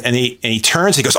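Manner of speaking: usual speaking voice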